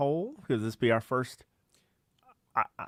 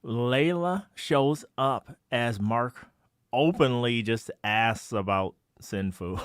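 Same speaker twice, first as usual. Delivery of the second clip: ordinary delivery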